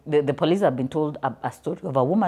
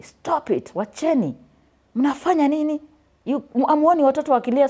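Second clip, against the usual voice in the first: put-on boys calling out; 12 semitones higher, 4 dB louder than usual. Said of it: "they were speaking in Swahili".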